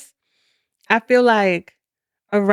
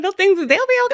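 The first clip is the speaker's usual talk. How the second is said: much higher-pitched self-imitation; breezy mock reassurance; 12 semitones higher, faster than usual. Wife retelling her reply to hesitant husband, high airy reassurance. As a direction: wave it away in a high voice.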